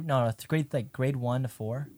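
usual speaking voice

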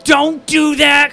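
high, loud mock dad voice; dad impression. Imitating girlfriend's dad to make her laugh, mock scolding. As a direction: yell it as a hammed-up fussy father. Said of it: "start doing an impression of her dad".

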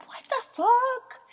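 high, slow, stretched-out what the fuck; mock disbelief. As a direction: drag it out in mock horror. Retold disbelief at the purchase.

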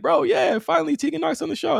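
excited fan finally getting his wish; hyped. Retold or imitated. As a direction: celebrate it like a fan. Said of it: "I'm hyped 'cause I'm like".

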